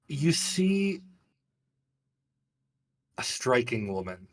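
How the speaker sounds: normal talking voice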